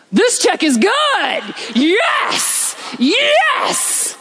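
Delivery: high, drawn-out cries of joy; hammed-up jubilant yell, slow and stretched; acted elation. Businessman reacts to huge check.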